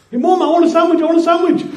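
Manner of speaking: put-on high, loud whiny child again; nagging child. Child pestering mum again, high and whiny. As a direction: whine for the sandwich like a pestering kid.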